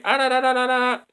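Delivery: high sing-song da da da sounds; mock robot gunfire. Reviewer mimicking Terminators running through buildings, playful high da da da.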